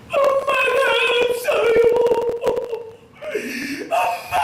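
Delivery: put-on high, slow, wailing child calling 엄마; a lost little boy sobbing and calling for his mother; crying child. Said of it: "세상 다 떠난 것처럼 그렇게 막 울고 있어요".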